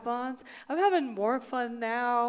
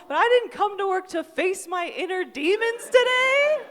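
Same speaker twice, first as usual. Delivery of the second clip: loud, high indignant yelling; high-pitched outraged disbelief; outraged rant; 6 semitones higher, 9 dB louder than usual. Retold or imitated. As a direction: yell it like you've been wronged. Outraged rant at rejection therapy.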